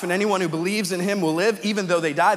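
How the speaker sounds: normal talking voice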